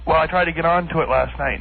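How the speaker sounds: normal talking voice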